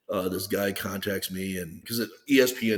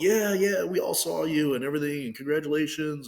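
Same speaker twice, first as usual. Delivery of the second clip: higher-pitched imitation of eager well-wishers; excited congratulations; 7 semitones higher than usual. Waitresses and owner congratulate the athlete, excitedly imitated. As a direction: retell their greeting with a lifted, eager voice. Townspeople congratulate him, retold.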